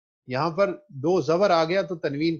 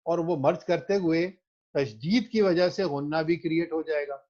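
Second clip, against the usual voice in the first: ordinary delivery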